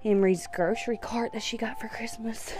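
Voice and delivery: hushed whisper. Real, not acted. Whispering while child sleeps.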